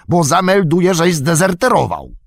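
high-pitched angry shout; yelled threat. Acted. Orc warrior threatening to report the deserting tracker, yelling. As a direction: shout a warning at the runaway. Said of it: "Wrzasnął bojowy ork".

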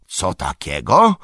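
surprised exclamation, rising at the end; mildly loud, startled question; Tom Bombadil. A narrator's reading. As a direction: call out in hearty disbelief. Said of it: "zawołał Tom Bombadil".

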